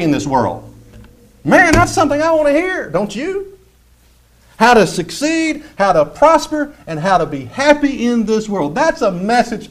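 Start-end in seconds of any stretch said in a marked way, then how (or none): 1.4-7.2 mock enthusiasm. Preacher mocks feel-good message.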